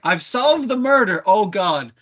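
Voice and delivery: high, slow, loud proud declaration; mock triumphant announcement. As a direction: crow it like a smug detective. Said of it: "Coming back is like".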